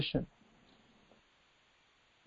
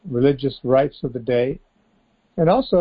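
normal talking voice

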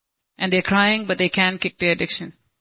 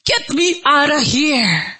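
higher, drawn-out cry to the Lord; pleading cry; 7 semitones higher, slower than usual. Acted. Stuck believer calling to the Lord, pleading cry. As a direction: cry out for rescue, slow and high. Acted plea to God.

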